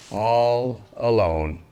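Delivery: ordinary delivery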